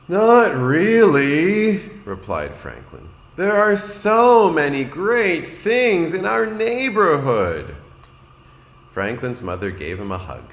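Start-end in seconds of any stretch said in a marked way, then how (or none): 0.1-1.8 high, loud, very slow and dragging; a little boy with no answer; drawn-out child voice; glum young Franklin. A narrator's reading. 3.4-7.8 high, loud, very slow and dragging; a little boy with no answer; drawn-out child voice; glum young Franklin. A narrator's reading.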